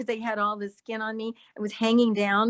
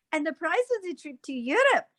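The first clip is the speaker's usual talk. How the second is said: higher, acted Japanese accent; 5 semitones higher than usual. Shown as a demonstration. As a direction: perform a movie line in a Japanese accent. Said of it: "I had to do a Japanese accent".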